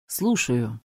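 low, heavy mournful answer. Acted. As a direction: answer the phone like it's a funeral. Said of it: "с таким траурным лицом".

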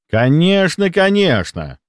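high-pitched, put-on understanding; falsely obliging; exaggerated understanding. A narrator's reading. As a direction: agree far too readily, mock-sympathetic. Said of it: "закивал с преувеличенно понимающим видом".

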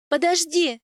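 high, loud, urgent cry. A narrator's reading. Wife calling after her departing husband, crying out. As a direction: call out to stop someone leaving. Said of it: "выкрикнула я".

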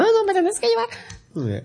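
high, drawn-out pleading imitation; mock insistence. Jane demanding to be taken along, mock insistence.

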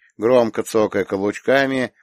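ordinary delivery

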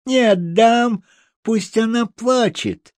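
очень высокий протяжный детский голос; тяни слова визгливо, упрямо; капризный визг. A narrator's reading. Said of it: "завизжала баронесочка". (визгливая упрямая девочка)

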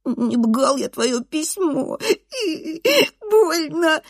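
put-on high, slow, sobby child protest; tearful nasal whine. A narrator's reading. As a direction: whine it on the edge of crying, R swallowed. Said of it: "лицо сморщивается в плаче". (плаксиво гундосит, картавя)

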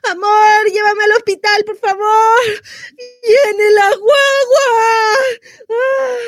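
high, pained wail for help; panicked labour cry. Acted. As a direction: call your partner urgently as the baby comes. Woman in labour calls partner. (grito angustiado de parto)

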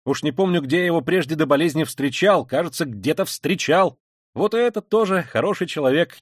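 high, loud, lively chatter; animated and emphatic; unusual animation. A narrator's reading. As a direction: rattle on with sudden eagerness. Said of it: "с каким-то необыкновенным до сих пор оживлением".

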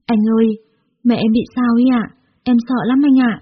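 tearful, scared. A narrator's reading. Daughter phones boyfriend about her sick mother, scared and upset. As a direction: voice a scared girl calling for help. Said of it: "Quỳnh đã khóc và gọi điện".

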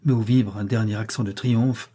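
usual speaking voice

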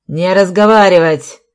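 very loud police command; shouted order. A narrator's reading. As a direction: shout to silence him. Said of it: "закричал капитан милиции". (громкий командный окрик)

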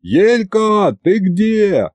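high-pitched searching call. Acted. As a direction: call for someone lost in a crowd. Searching the crowd for someone.

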